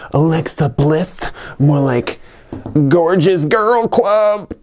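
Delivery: high, slow punchline; joke voice. Pretend. Joking to viewers in a high silly voice. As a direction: pitch it way up for the gag. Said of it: "All right, I'll make a joke".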